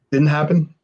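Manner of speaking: ordinary talk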